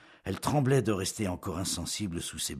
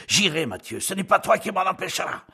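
much higher, loud yell of defiance; defiant shout; 13 semitones higher, 9 dB louder than usual. Acted. Jean to Mathieu, a loud defiant shout. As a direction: shout back, refusing to back down. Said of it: "Jean se mit à crier".

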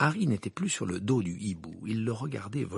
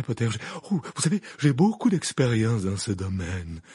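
overexcited teacher; 5 dB louder than usual. Acted. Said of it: "l'air surexcité".

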